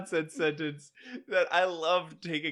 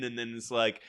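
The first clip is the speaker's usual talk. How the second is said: ordinary talk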